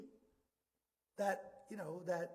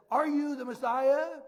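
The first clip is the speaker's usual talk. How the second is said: loud, slow, higher-pitched demand; high priest's challenge; 4 semitones higher, 9 dB louder, slower than usual. Acted. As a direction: press the question slowly and forcefully. High priest questions Jesus, acted.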